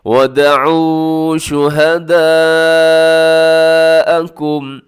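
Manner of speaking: very slow, loud melodic tajweed chant; long madd in शुहदाअकुम; Quran recitation. Shown as a demonstration. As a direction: stretch the madd in full voice. Recites the verse.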